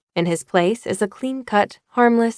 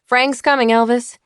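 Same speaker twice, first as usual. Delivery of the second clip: raised-pitch teasing call; mock warning, pitched up; 5 semitones higher, 4 dB louder than usual. A narrator's reading. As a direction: call it out to tease a friend. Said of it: "Red and Sonny teased him".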